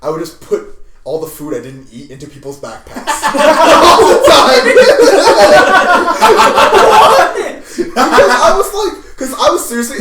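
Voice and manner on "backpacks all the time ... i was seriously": high loud laughter through the words; can barely get it out for laughing; laughing hard. Real, not acted. Confessing to friends about stuffing backpacks, laughing hard.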